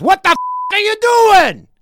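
high, loud outraged yell; angry waiter. Retold or imitated. Waiter scolding diners, shouting high and loud. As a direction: bark it at the table like a furious waiter. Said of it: "the guy who gave the specials comes back and goes".

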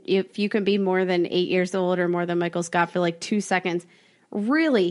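usual speaking voice

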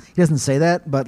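normal talking voice

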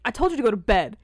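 a dad scolding loudly; stern dad. Retold or imitated. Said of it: "he's like".

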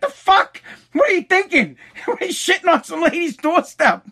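retelling mom's outrage in a high put-on voice; imitating mom. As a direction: squeak out his mom's disbelief. Mom scolds, voiced in story.

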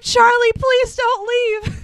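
high, loud and slow begging wail; wife locked in the closet begs her husband, mock wailing; hammed-up plea. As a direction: whine it out like a desperate wife.

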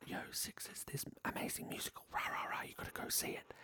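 excited rumour passed along in a hushed whisper; whispered word of mouth. Acted. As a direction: whisper it like a hot tip. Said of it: "things start kind of whispering out".

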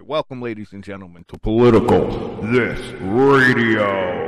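booming, drawn-out radio host welcome; announcer voice. Shown as a demonstration. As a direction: open the show like a big-time DJ. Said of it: "let me do it the right way".

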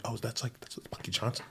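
very soft, near-whispered murmur; under the breath, as if not to be overheard; hushed retold aside. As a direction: imitate onlookers quietly spotting someone famous. Retold onlookers spotting a celebrity.

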